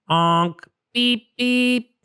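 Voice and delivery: high honking beeps; playful horn noises; mock car horn. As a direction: honk like a little car horn. Mother imitates car horns.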